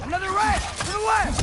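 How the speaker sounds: loud, high warning yell; urgent combat callout; shouted warning. Acted. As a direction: shout it so your ally hears mid-fight.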